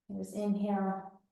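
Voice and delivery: soft breathing cues, almost whispered; quiet guided breathing. Acted. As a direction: ease them into calm.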